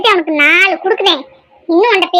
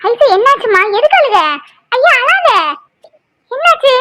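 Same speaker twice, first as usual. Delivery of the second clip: high-pitched, anxious questioning; alarmed concern; 4 semitones higher than usual. Acted. Woman asks crying Aishu what happened, alarmed and reassuring. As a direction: fuss over someone in tears, voice raised high.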